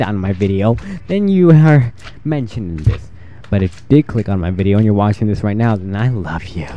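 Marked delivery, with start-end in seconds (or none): none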